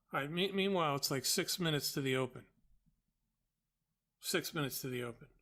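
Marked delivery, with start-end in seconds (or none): none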